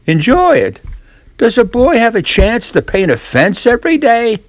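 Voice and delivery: put-on high incredulous boyish voice; mock astonishment. Tom feigning delight to Ben, high mock astonishment. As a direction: act amazed anyone would ask.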